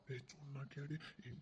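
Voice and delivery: urgent hushed plea; whisper. Acted. Husband urging his wife at night, barely audible. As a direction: whisper it so nobody wakes.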